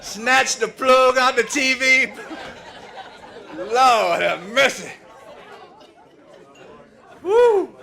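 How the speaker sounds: loud, laughing through the words; cracking up, half shouting; laughing loudly. Real, not acted. Comedian laughing at the crowd as the lights go off, loud laughing.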